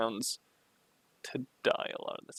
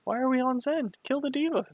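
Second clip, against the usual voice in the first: exasperated yelling; 11 semitones higher, 5 dB louder than usual. Real, not acted. Coach yelling at VOD mistakes.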